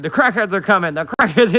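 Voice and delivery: Paul Revere call. Retold or imitated. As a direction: call it out like a rider sounding the alarm. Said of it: "Paul Revere word".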